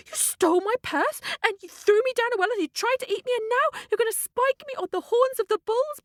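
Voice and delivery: high, indignant cockerel voice; disbelieving cockerel. Acted. Cockerel to the servants grabbing him, outraged disbelief. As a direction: protest each wrong high and incredulous. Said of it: "the cockerel couldn't believe it".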